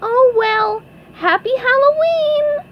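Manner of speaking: very high, loud cartoon cheer; cheerful squeaky cry. A narrator's reading. SpongeBob wishes the fleeing crowd well, high cheery squeal. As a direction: squeal the greeting brightly to everyone.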